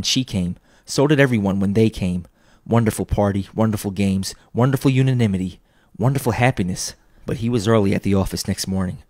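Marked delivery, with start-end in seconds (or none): none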